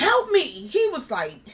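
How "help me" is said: high, loud plea; demanding help. Retold or imitated. Daughter to laughing father, high urgent retold plea. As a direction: insist he take you seriously.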